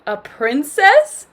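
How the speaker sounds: loud, slow, high eager question; excited cry. A narrator's reading. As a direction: cry it out, thrilled at the news. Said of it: "Shrek cried".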